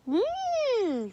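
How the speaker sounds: high, loud drawn-out hum; long skeptical storybook hum; pondering hum. Acted. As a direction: hum high, sizing someone up.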